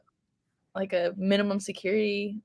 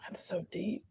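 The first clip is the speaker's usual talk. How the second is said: whisper; 12 dB quieter, slower than usual. Real, not acted. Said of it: "whispered remark".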